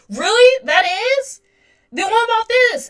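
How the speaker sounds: high, loud astonished questions; shocked disbelief. Retold or imitated. Herself reacting to hearing her habit is trauma, shocked. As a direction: react as if floored by the news.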